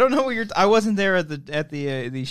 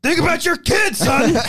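higher, barking coach imitation; 13 semitones higher, slower than usual. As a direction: bark it like a tough coach. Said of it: "He's like".

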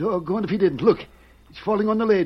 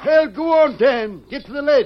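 raised, louder urgent voice; excited loud command; urgent urging; 7 semitones higher, 4 dB louder than usual. Acted. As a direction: push someone to hurry, voice pitched up. Sheriff urgently urges boy on.

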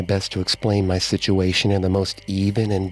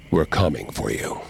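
much lower, ominous many-voiced threat; low and menacing, like many dead at once; chorus of spirits; 7 semitones lower than usual. A narrator's reading. Spirits speaking through the medium, low ominous threat. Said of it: "Several voices spoke through Coon's mouth".

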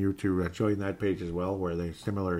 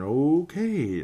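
drawn-out, rising okay of disbelief; higher-pitched mock shock; 5 semitones higher, 3 dB louder than usual. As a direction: stretch it higher, like a stunned nerdy guy. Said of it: "like, shocked".

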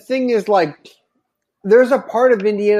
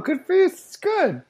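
"Good for you" jumps way higher, a squealed echo; squeaky high cheer; 11 semitones higher, 3 dB quieter than usual. Real, not acted. Hyping up a friend after her voicemail, in a high squeal.

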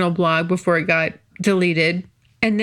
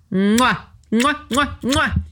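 blowing kisses; 3 semitones higher, slower than usual. Real, not acted. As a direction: blow big kisses to your fans.

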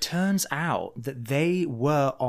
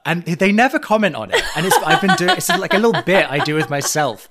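louder, much higher laughing talk; 7 semitones higher, 9 dB louder, faster than usual. Real, not acted. Host amused by his own birthday-card prank, laughing. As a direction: giggle through it.

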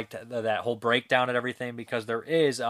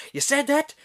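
high, loud, incredulous Irish brogue; thick Irish accent; 14 semitones higher, 5 dB louder than usual. Retold or imitated. Sergeant questions the insult, high-pitched Irish accent. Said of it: "he's got this thick Irish accent".

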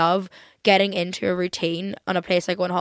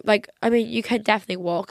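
ordinary talk

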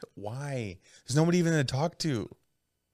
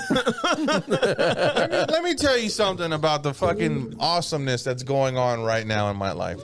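ordinary talk

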